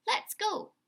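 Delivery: high, loud impatient urging; boy eager to leave for the fair. Acted. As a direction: hurry everyone along like an eager boy. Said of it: "Sam was impatient".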